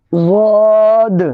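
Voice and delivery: ضاد drawn out very slowly and fully; teacher sounding a letter. Shown as a demonstration. Teacher showing children how to say ضاد, slowly drawn out. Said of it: "teacher sounding out letters".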